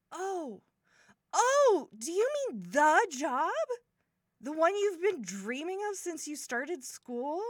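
loud, high burst of amazement; excited realisation. Acted. Learning brother's friend got the dream job, excited surprise. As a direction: let the good news hit you, voice leaping up.

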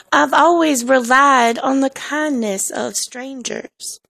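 slow mock Southern drawl; Southern accent. Shown as a demonstration. Teasing people from the South, slow Southern accent. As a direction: draw it out like a Southern belle. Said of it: "offend people from the South and say".

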